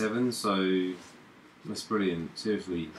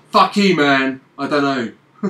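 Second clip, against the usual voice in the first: mock outburst; 4 semitones higher, 12 dB louder than usual. Real, not acted. Laughing fake outburst.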